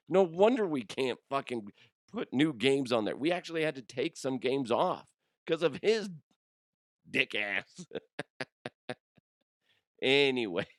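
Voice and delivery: ordinary talk, nothing marked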